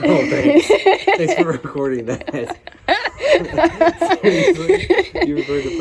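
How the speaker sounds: embarrassed laugh through the words; laughing, caught out. Real, not acted.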